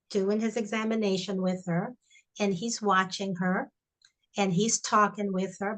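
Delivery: usual speaking voice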